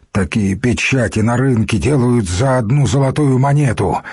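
usual speaking voice

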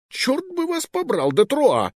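very high, exasperated curse; irritated outburst. Acted. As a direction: toss it off in annoyance. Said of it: "раздражённо бросил король".

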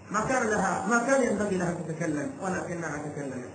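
soft, falling lament from the pulpit; subdued preacher's reproach, sinking away; hushed regret. Acted. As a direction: drop to a low, quiet note of regret. Preacher laments the girl's fatal step.

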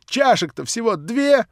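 high, loud, slow, challenging; defiant triumph. Acted. Nelly after smashing the cup, defiant triumph. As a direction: gloat defiantly. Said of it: "прибавила она".

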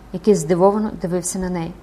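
usual speaking voice

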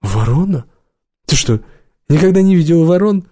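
astonished questions leaping high in pitch; surprised. A narrator's reading. Visitor asks the girl about crows, surprised. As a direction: ask in rising disbelief. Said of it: "Убер удивился".

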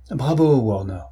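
understated exclamation of approval; mild cry. A narrator's reading. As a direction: call out a bravo, lightly projected. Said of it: "cria Holmes". (exclamation approbatrice, légèrement criée)

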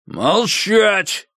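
high, sharp command shout; shouted order. A narrator's reading. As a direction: bark for silence. Colonel orders silence.

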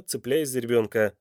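usual speaking voice

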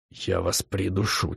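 low, slow whispered threat. Acted. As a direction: mutter it through clenched teeth, barely audible. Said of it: "тихо прошептал я".

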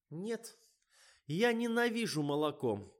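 high, thin plaintive protest; whiny refusal. A narrator's reading. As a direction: whimper like a reluctant actress. Actress whines her refusal.

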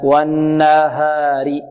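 slow والنهار with a light open haa; drawn-out والنهار with thin, clear ها; correct, no tafkhim. Shown as a demonstration. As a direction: recite والنهار, holding the n, haa not darkened. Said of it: "تفخيم، لا".

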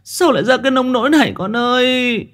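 high, wailing grief-stricken cries; sobbing mother. Acted. Mother sobbing to her dead daughter's spirit, wailing. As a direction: wail for your lost child. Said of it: "bà khuỵu xuống ôm mặt nức nở".

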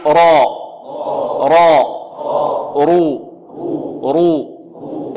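"راء، رو" with thick R, vowel held, pause after each; heavy ra drill. Shown as a demonstration. Teacher leads class repeating after him, heavy ra. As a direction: sound out "راء" then "رو" heavily and long. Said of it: "انطقوا معي".

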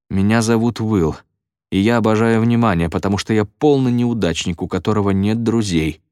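ordinary delivery, nothing marked

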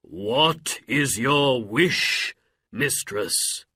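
slow, grand question; booming genie. A narrator's reading. Genie offering Aladdin's mother a wish, booming. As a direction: intone it with long drawn-out vowels. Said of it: "cried the genie".

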